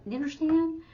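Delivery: hammed-up high patronising question; mock condescending. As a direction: squeak it out, patronising. Mocking the phrase to avoid.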